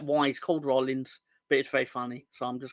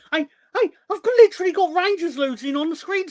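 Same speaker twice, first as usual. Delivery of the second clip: much higher and louder, riled up; excited protest; 13 semitones higher, 7 dB louder, faster than usual. Real, not acted. Streamer protesting to chat about the score, high and loud.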